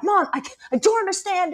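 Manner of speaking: put-on a kid near tears asking for help; frustrated kid voice.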